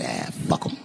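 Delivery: low breathless whisper; a giving-up aside, barely voiced; dismissive mutter. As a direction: toss it off under your breath. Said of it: "and I said".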